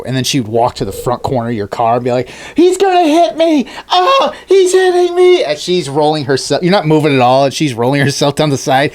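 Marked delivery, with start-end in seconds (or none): 2.6-5.4 hammed-up high shrill cries of a woman faking being run over; mock victim screaming. Imagined Karen at a stranger's car, hamming up a high mock scream.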